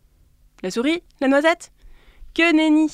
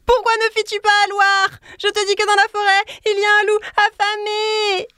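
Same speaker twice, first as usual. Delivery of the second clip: high, loud pleading call; exasperated shout; 11 semitones higher, 3 dB louder than usual. A narrator's reading. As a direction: shout in frustration at the sleeper. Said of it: "cria de nouveau le lièvre".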